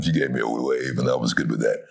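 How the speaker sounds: ordinary delivery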